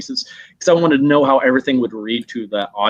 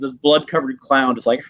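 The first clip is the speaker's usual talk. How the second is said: ordinary talk, nothing marked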